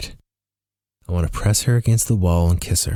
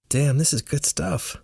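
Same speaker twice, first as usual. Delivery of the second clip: higher, softer savoring tone; pleased, pitched-up murmur of approval; savoring delight; 8 semitones higher, 5 dB quieter than usual. A narrator's reading. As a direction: quietly relish every bite. Savoring food at dinner.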